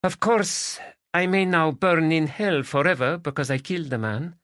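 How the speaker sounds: put-on high, accented Spanish woman's voice; Spanish lady. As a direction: voice her as a lilting Spanish lady.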